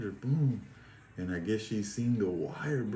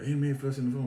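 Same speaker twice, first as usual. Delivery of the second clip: quick retold shout, higher and louder; raised-voice mock alarm; imitated yell; 5 semitones higher, 5 dB louder, faster than usual. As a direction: mimic the guard hollering a report. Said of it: "that bitch yelled".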